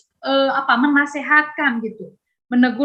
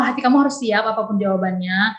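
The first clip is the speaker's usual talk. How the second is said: usual speaking voice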